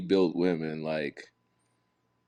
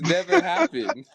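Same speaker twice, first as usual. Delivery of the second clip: exasperated yell; 6 dB louder than usual. Real, not acted. Mock outrage yell.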